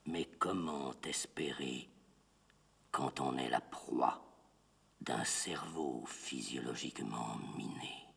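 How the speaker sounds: low, soft and despondent; grave, hushed recitation. Acted. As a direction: sink into a low, heavy murmur. Grave question within recited poem. (récitation grave, basse et accablée)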